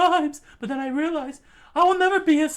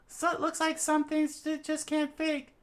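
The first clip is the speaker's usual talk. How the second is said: lightly voiced bystander; 6 dB quieter than usual. A classmate commenting on the pair, softer aside. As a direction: murmur it as a side character. Another figure remarks.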